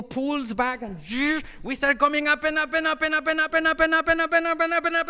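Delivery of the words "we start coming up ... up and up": rising excited build. Retold or imitated. Plane climbing out of storm.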